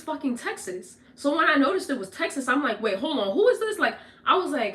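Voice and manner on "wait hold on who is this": retold alarm on the phone; startled question. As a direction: jump up in pitch, suspicious and startled. Realizes stranger on the phone.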